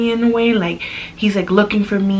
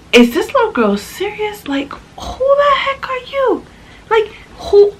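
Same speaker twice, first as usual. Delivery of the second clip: inner outrage; 12 semitones higher than usual. Retold or imitated. Inner reaction to the rude little girl, high mock-crying disbelief. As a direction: wail it in disbelief, pitch way up. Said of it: "I'm like".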